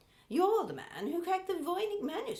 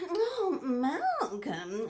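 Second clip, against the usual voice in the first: slow laughing sigh of his name; breathy swoon; 4 dB louder, much slower than usual. Acted. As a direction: melt over the name, laughing. After a gasp, swooning.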